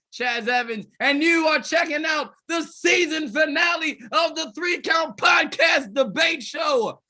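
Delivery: booming ringmaster announcer. Acted. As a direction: hype up the crowd like a ringmaster. Podcast host hype intro.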